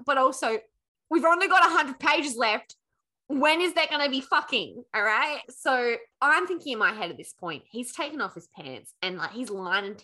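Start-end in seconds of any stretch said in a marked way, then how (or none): 0.0-4.8 loud, raised-pitch reader's complaint; exasperated impatience. Real, not acted. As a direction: demand it, higher and louder, fed up waiting. Impatient reader complains about the plot.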